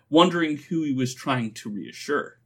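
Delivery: usual speaking voice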